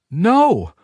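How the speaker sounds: high, loud refusal; defiant no. A narrator's reading. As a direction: refuse him outright.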